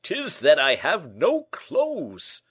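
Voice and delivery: loud, high, slow lament; despairing confession. Acted. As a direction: wail it out with great drama. Porthos confesses his trouble in despair.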